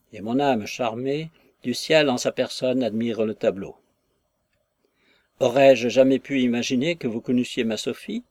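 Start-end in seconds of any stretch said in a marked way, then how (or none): none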